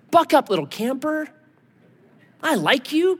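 high, patronizing cheer-up voice; sugary mock consoling; mock pep talk. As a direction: coo encouragement as if to a child. Mock pep talk God never gives.

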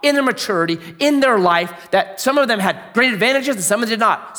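high, driving preacher's delivery; impassioned preaching. Real, not acted. Preacher praising a mentor who treated people as individuals, impassioned preaching. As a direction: build the point phrase after phrase.

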